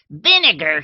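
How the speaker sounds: put-on old lady scoffing loudly; scornful. A narrator's reading. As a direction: spit the word back in disbelief. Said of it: "she said with scorn".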